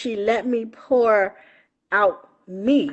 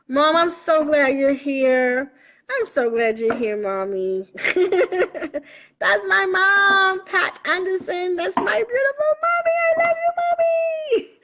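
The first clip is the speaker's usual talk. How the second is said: rising high with delight, much higher; gushing to mom; 9 semitones higher than usual. Real, not acted. Greeting her shy mom on the live stream, gushing happily. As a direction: squeal with joy over her mother.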